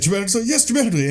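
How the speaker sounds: high, arch back-and-forth; lightly voiced posh pair; theatrical exchange. Minstrel and Lancelot agreeing to exit dramatically, high arch tone. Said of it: "He's like".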